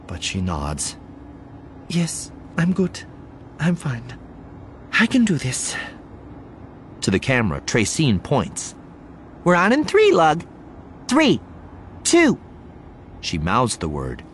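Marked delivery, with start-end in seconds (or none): 1.9-5.7 high, hesitant self-reassurance; nervous, halting character voice, pitched up; strained. A narrator's reading. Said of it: "The smile on her face is strained". 9.4-12.4 high, loud on-air countdown; punchy, spaced-out countdown; broadcast countdown. A narrator's reading. Reporter counts down to camera.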